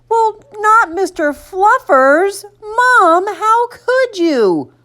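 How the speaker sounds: high, slow, drawn-out dismay; aghast child. A narrator's reading. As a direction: wail in protest like a betrayed little boy. Boy aghast at losing bunny.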